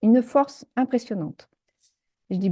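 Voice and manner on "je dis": normal talking voice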